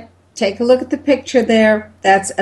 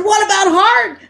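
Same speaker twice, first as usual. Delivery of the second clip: high shouted question; yelling; 12 semitones higher than usual. Retold or imitated. Doctor yelling his next question at the wife. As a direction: yell it across the room. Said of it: "They're yelling at me".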